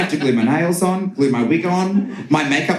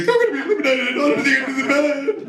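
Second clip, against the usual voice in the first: panicked inner voice; 11 semitones higher, much faster than usual. Retold or imitated.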